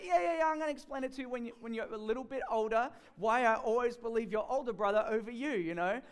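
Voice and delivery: fast, high, brushing it off; dismissive mother. Retold or imitated. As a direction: wave the kid away, fast and high. Mother brushes off younger son.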